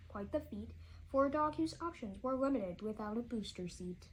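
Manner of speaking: ordinary delivery, nothing marked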